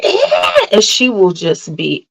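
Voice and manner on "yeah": very high, short mock screech; child's screech imitation. Mom mimicking her little one going off, high screech. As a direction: imitate a little one's piercing squeal. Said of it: "she'll just be like".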